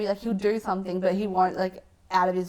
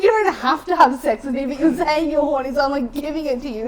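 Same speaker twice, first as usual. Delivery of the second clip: raised, louder voice, acting out impatience; exasperated retort; 6 semitones higher, 6 dB louder than usual. Teller replays answering a hesitant lover, exasperated. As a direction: push it higher, as if stating the obvious. Retelling her exasperated reply to him.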